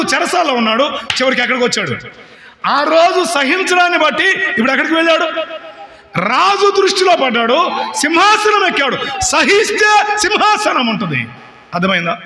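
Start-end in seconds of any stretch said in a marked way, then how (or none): 2.7-11.3 high and building, slowing on the final line; rising triumphant preaching. Acted. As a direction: lift the crowd to the throne. Triumphant climax of the point. (విజయోత్సాహంతో పెరుగుతున్న ప్రసంగం)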